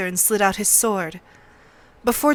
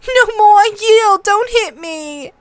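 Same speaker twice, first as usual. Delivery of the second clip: high, loud panicked pleading; shrill begging of a beaten boy; 12 semitones higher, 7 dB louder than usual. A narrator's reading. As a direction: shriek for mercy, very high and loud. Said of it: "he shrilled".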